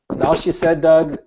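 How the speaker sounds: normal talking voice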